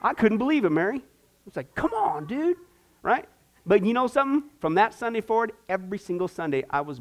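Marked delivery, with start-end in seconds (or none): 1.7-2.6 high, mock exasperated. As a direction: throw up your hands. Exasperated retold reaction.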